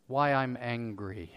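slow, low and soft; subdued self-question. Acted. Preacher examining himself before the congregation, subdued. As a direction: say it quietly, taking stock of yourself. Calm evaluating, then acted outburst.

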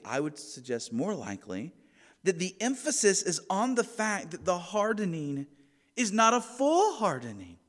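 normal talking voice

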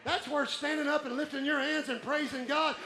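exhorting preacher at high intensity; fired-up preaching. Real, not acted. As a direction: cry out to the congregation with full conviction. Preaches at full force.

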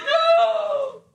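high shrieked no; dramatic scream. Retold or imitated. Isaac screams as Abraham binds him, loud. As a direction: cry out like a movie hostage.

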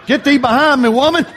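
hammed-up holy scolding, laugh at the end; mock rebuke. Jokingly rebuking his wife.

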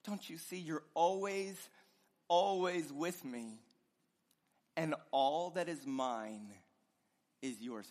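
soft, low reassuring voice; gentle father. Acted. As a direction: speak tenderly to a hurt son. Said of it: "And he said to him".

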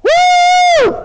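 high, drawn-out whooping joy; acted ecstatic hollering with a whoop; jubilant celebration. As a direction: crow it out while dancing on deck. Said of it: "Joe is just dancing".

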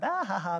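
high, loud mock laugh of a giddy guy. Preacher acting out giddy friend, high loud laugh. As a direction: ham up a lovesick friend's cackle.

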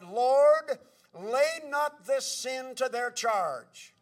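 loud, drawn-out plea to the Lord; crying out. Retold or imitated. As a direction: cry out a dying man's prayer, slowly. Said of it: "Stephen cried".

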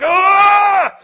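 high loud strangled cry; Popeye roar. Retold or imitated. Preacher imitating Popeye about to snap, loud cry. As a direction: let out a cartoon sailor's yell. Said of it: "I can't do a Popeye voice".

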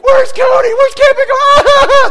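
very high, loud panicked shriek; screaming kids. Retold or imitated. Kids lose their counselor in the dark, panicked screaming. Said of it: "They're screaming".

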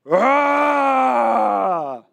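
loud mock roar; roar back. Little Pete roars back at the grizzly, loud roar. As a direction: roar right back at the bear. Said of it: "and he goes".

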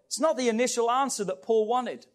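ordinary talk, nothing marked

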